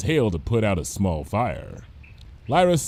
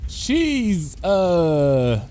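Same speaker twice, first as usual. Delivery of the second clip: faltering; 7 semitones higher than usual. Acted. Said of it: "voice came to a faltering stop".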